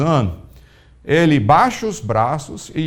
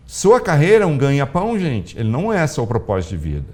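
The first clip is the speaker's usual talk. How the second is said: usual speaking voice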